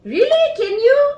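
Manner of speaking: high, surprised disbelief; genuinely doubtful questions. Real, not acted. Doubting friend's claim.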